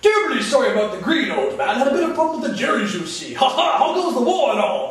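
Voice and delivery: loud, high, hearty British officer; breezy upper-crust Brit calling out cheerily; British fighter pilot. Acted. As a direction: play up jolly British understatement at full volume. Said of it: "British being the masters of understatement".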